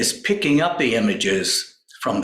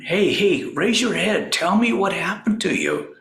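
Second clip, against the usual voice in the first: higher-pitched, urgent coaxing; urging; 7 semitones higher than usual. Retold or imitated. Doctor to weeping patient with bent head, urging. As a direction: call him out of it, pitched up. Retold rousing of crying patient.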